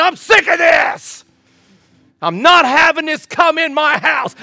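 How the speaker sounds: loud retold shout at home; angry. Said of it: "I said".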